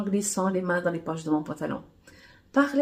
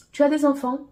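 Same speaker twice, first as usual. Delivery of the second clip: pressing question; 8 semitones higher, 7 dB louder than usual. A narrator's reading. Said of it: "Poursuit Miss Univers".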